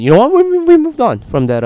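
a hammed-up locker-room cliché; mock team spokesman. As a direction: mimic a team shrugging off a collapse. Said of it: "they said".

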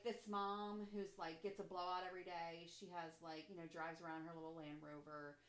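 ordinary talk, nothing marked

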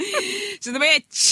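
high, slow drawn-out taunt; exaggerated high-pitched repeat of the dig; cheeky insult. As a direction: draw it out high and teasing. Repeats the insult after protest.

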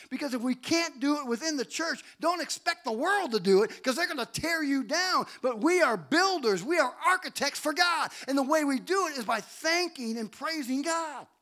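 full-force preaching. Real, not acted. Preacher urging the church to build one another up, full-force preaching. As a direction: exhort them with mounting force.

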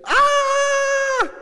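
high, loud terrified scream; panicked scream. Acted. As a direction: cry out as the boat floods. Acted disciples panicking in storm.

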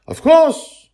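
put-on high, loud child asking back; a small boy confused by the question; puzzled little boy. Charlie child voice.

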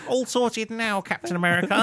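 high-pitched hammed-up hero voice; plug superhero. Friend playing Russ Plug to Captain America, high put-on voice. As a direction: report in, pitched well above your own voice. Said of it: "what's his secret identity?".

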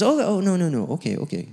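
high, panicky oh no, then settling; mock alarm that fades to a meek okay; flustered backing down. Man on Skype, startled, hurries to agree.

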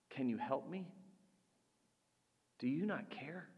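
quiet, small, almost hopeless asking; soft plea. Acted. A believer quietly asking God for help, hushed. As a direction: let the question fall away softly. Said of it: "the words we often address to God".

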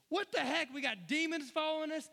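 loud, high, incredulous protest; exasperated outburst. Acted. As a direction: blurt it out in loud disbelief. Acting out the missionaries' outrage.

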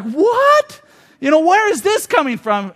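high, loud startled what, then bewildered question; a girlfriend blindsided by a breakup; shocked what. Retold or imitated. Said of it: "She's like".

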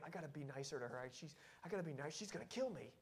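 very soft, quick private thought; hushed inner thought. Retold or imitated. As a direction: mutter it to yourself.

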